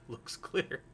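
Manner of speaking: hushed all-clear. Retold or imitated. Movie character looks again after the alien, hushed whisper. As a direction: barely voice it, imitating a wary lookout.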